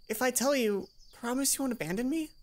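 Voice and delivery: Mai begging not to be left; Mai, timid. Acted. Mai asking shyly.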